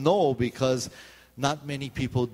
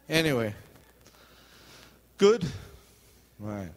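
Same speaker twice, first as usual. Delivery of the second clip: normal talking voice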